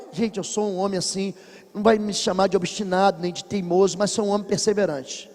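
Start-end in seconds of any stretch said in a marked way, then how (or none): none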